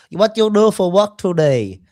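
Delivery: mock Vietnamese accent, a barber quizzing a client. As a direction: barber asking about work, light Vietnamese accent. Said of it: "Is this the wrong show for my accents?".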